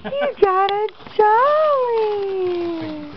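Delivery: excited. Real, not acted. Excited mom to baby.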